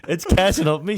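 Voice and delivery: a loud, amused repeat of "It's catching up me"; excited. Real, not acted. Laughing at his own lies.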